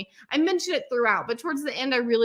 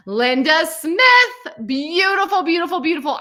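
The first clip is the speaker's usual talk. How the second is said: excited announcement; 6 semitones higher, 8 dB louder than usual. Real, not acted. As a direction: call the name out like a proud host. Announcing a graduate's name joyfully.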